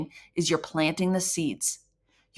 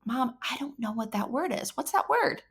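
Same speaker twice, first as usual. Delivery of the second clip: put-on child asking; 5 semitones higher, 6 dB quieter than usual. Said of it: "they're like".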